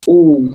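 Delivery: loud, held "u" with round lips; emphatic demo of a round "u"; rounded dammah. Shown as a demonstration. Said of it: "pengucapan duma harus memang bulat".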